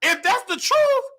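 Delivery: mock breathless gasping, high voice; imitating someone gasping for air; out of breath. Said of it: "and she out of breath".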